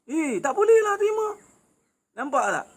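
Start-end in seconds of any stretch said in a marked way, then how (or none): 0.1-1.3 much higher, shrill-edged protest; indignant wife. Retold or imitated. Preacher imitating a first wife who can't accept it, very high and hammed-up.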